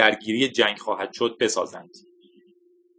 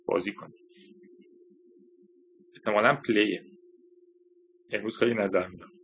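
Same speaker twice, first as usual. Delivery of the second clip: soft, low aside to oneself; quiet aside; 3 semitones lower, 6 dB quieter than usual. Real, not acted. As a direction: drop out of the reading, much softer. Reader's muttered aside about the text. (زمزمهٔ کنارگویی، آهسته و بم)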